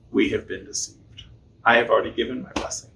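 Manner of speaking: low, soft and grave; old father quietly realizing he was tricked, low and soft. Acted. Isaac realizes the deception.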